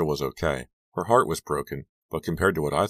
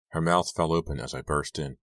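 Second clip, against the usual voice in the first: ordinary delivery, nothing marked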